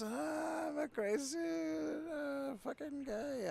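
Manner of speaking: high, slow squeaky wail; mock crazy. Gamer voicing a crazed guy, high wail. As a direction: whine it like a crazed creature.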